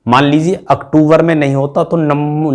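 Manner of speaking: normal talking voice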